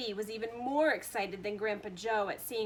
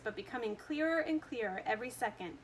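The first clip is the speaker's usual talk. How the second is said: ordinary delivery, nothing marked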